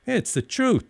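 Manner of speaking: high-pitched indignant protest; wounded protest, pitched well up. A narrator's reading. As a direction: insist indignantly, voice jumping up high. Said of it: "Dennis said indignantly".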